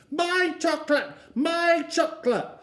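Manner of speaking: put-on high, loud, wailing toddler. Eddie screaming after the chocolate is taken back. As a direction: wail like a toddler losing his treat.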